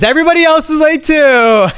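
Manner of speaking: giddy relief. Real, not acted. Relief at others also late.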